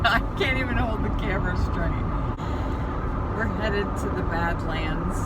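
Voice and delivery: talking over road shake and noise; on a bumpy road. Real, not acted. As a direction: voice jolted by a rough highway. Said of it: "Sorry about the shaking".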